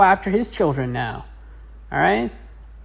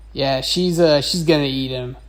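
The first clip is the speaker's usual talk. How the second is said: ordinary delivery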